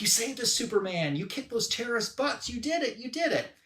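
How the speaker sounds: high-pitched imitation of grateful fans; cheering crowd. Crowd thanks Superman for saving them, cheering. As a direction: cheer the hero in a light, high voice. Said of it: "And the crowd is like".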